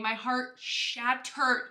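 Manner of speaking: ordinary talk, nothing marked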